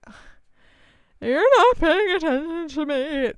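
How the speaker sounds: high, loud, hammed-up whine; mock whining. Itchy woman to sympathetic partner who isn't attentive enough, mock whiny. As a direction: whine it like a neglected child, exaggerated. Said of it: "I'm, like, so whiny".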